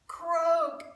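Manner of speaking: high, loud frog croak; bellied croak. Acted. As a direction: belt out a big frog croak. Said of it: "he bellied a".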